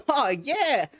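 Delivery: high, excited whoop; giddy kid. Acted. Hasted runner boy cheers, high and giddy. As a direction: burst with glee. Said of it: "he gets all giddy".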